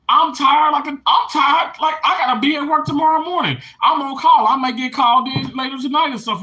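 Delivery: high, loud, exasperated venting; fed up. Real, not acted. Venting to viewers about work tomorrow, fed up.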